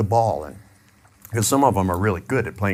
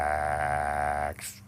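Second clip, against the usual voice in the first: slow, drawn-out, lower "Relax"; 5 semitones lower, 6 dB quieter than usual. Shown as a demonstration. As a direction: stretch "Relax" out long and soothing. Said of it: "And drag the word out".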